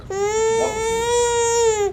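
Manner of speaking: high, loud sobbing voice; words breaking into tears; crying. Real, not acted. Crying reply.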